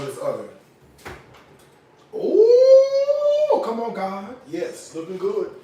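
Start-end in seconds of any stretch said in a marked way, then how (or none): 2.1-3.6 a loud, high, sung-out ooh of delight; high delighted squeal. Real, not acted. Checking her oven, a high delighted squeal. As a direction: squeal at how good it looks.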